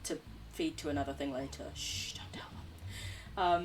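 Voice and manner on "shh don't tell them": very slow secretive whisper. Real, not acted. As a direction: let the viewers in on a secret. Hiding plan from slimes.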